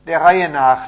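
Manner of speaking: amused call. A narrator's reading. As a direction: call the eager crowd to order. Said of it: "lachte der Mann".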